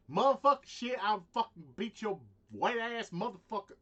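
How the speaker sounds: loud, high imitation of an aggressor; mock aggressive threat. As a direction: act out someone coming at you yelling.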